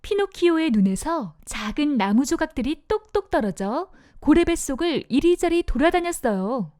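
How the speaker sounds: plain reading voice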